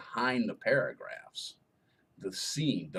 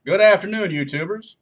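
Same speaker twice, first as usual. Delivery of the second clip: big greeting; 9 semitones higher, 10 dB louder than usual. Real, not acted. Loud on-camera greeting.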